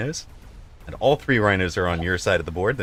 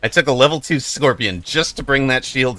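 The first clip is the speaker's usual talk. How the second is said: excited; 5 semitones higher, 5 dB louder than usual. Real, not acted. Excited cast reaction.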